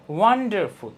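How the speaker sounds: first syllable of wonderful hit hard; stressed syllable. Shown as a demonstration. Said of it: "ওইটুকু হলো stressed".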